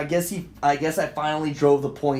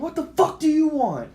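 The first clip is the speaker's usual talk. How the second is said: high, angry re-enacted demand; pitched-up retold outburst; retold going off; 12 semitones higher than usual. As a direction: snap it at an unwanted caller. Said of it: "I'm fucking going off".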